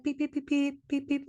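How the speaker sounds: imitated car horn, quick high beeps; short repeated honks. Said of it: "it wasn't just, like".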